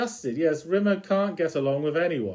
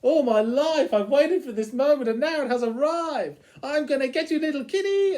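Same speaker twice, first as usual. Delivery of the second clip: much higher, thrilled and eager; squealing with delight at the news; the Cat rushing off to find his match; excited Cat; 10 semitones higher than usual. Retold or imitated. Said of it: "your opposite will be".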